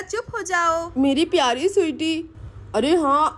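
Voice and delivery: ordinary talk, nothing marked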